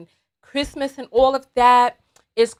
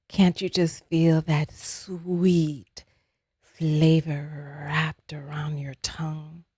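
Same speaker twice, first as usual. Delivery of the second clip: low, slow, whispery seduction; soft sensual voice; 7 semitones lower, 6 dB quieter, slower than usual. Acted. Ice cream seducing a dieter, soft and sensual. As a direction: purr it softly and slowly, like temptation itself. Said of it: "real soft and just very sensual".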